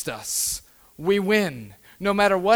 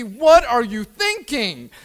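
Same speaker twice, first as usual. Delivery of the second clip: loud, drawn-out exasperated question; yelling at the TV; 3 semitones higher, 7 dB louder, slower than usual. Retold or imitated. Husband to the TV as his wife watches, exasperated yell. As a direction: re-enact a slow, incredulous yell at the screen. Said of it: "yelling at the T V".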